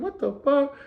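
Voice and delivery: high, startled what the fuck; shocked squeal at the new rules. Retold or imitated. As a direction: jump up in disbelief. Shocked reaction quote.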